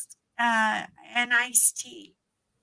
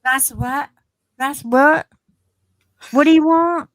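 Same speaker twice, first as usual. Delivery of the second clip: puzzled questions building higher and louder; baffled Texan listener; 3 semitones higher, 9 dB louder than usual. Retold or imitated. Texan fails to understand a request for water, baffled. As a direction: lightly imitate a confused Texan, getting louder. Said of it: "no one could understand me".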